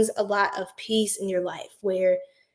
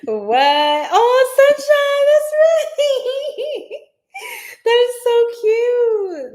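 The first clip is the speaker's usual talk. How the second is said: much higher, loud giggly talk, slow; delighted laughing squeal; 13 semitones higher, 7 dB louder, much slower than usual. Real, not acted. Reader squeals with laughter at a viewer's joke.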